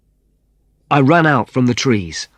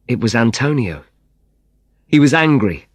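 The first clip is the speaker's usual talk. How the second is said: ordinary delivery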